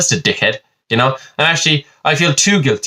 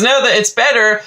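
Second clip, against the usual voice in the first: exaggerated whine; 11 semitones higher, slower than usual. Mock complaint about modern life.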